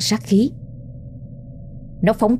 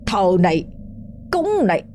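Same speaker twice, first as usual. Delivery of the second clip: mocking curse while kicking; 2 semitones higher, 3 dB quieter than usual. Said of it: "Hắn vừa đạp vừa chửi".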